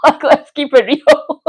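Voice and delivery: trying to talk while still laughing. Real, not acted. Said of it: "I can't stop laughing now".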